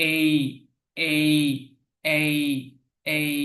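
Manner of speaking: "এই" with a short i glide at the end; British letter A. Shown as a demonstration. Teacher drills British A for learners, gliding pronunciation. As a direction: end each "এই" on a light short-i sound. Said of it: "একটু রস্বইর মতো".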